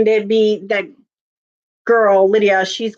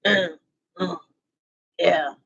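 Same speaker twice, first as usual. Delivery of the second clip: soft, low mumbled grunts; a hint of Daryl's wordless mumble; imitating Daryl's grunts; 4 semitones lower, 6 dB quieter, slower than usual. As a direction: grunt like a man with no lines.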